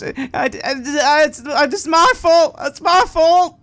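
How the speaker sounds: high, loud, broken cries of blame; crying, insisting it is all his fault; guilty sobbing. Acted. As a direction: choke out the guilt between sobs. Nigel spirals into guilt.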